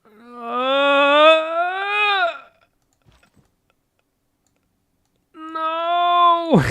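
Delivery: rising high-pitched wail of dismay; drawn-out cry of despair; long wailing no. Real, not acted. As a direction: draw out a high, anguished "no". Enemy about to pillage his sites.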